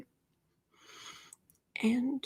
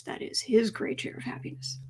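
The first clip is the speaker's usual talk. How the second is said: soft aside; 7 dB quieter than usual. Real, not acted. Aside about the cat.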